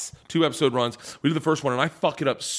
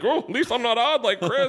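mock relief; 10 semitones higher than usual. Retold inner thought, high.

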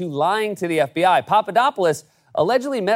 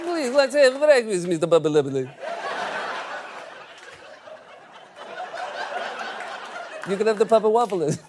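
put-on Russian accent; 4 dB quieter than usual. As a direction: purr the name in a Russian accent. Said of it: "saying your name in a Russian accent".

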